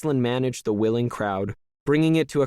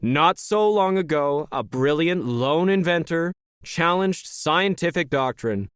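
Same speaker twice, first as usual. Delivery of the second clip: raised pitch, measured public oratory; rally speaker; 6 semitones higher than usual. Acted. Leader tells the crowd of recent history, stage oratory. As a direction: address the hall like a practised orator.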